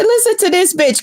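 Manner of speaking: mock disbelief at what she said; incredulous scoff. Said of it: "I said".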